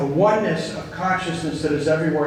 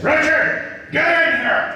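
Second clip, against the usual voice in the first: stern boss calling; 10 semitones higher, slower than usual. Retold or imitated. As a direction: bark his name like an angry boss. Retold boss summoning him.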